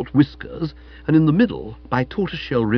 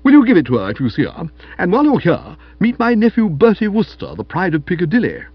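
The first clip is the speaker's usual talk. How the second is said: raised, emphatic aunt's voice; brisk aunt; 5 semitones higher, 5 dB louder than usual. Acted. Aunt giving visitor a telegram and introducing nephew, brisk. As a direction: hand over and introduce with hearty force.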